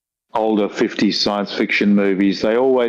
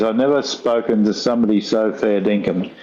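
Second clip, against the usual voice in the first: normal talking voice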